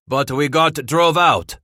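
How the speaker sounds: loud bellow across the fire. A narrator's reading. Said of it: "Henry Frey bellowed".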